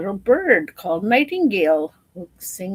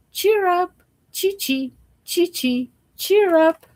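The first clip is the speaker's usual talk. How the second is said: birdsong chirp; 11 semitones higher, 3 dB louder than usual. Acted. Nightingale singing to emperors.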